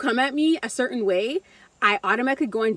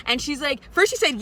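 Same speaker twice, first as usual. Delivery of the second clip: normal talking voice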